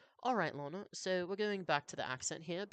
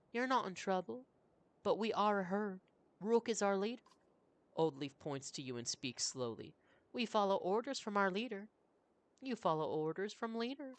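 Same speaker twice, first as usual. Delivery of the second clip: lightly raised, patient elder woman; 6 semitones higher than usual. Acted. Old Leaf speaks slowly to Roach.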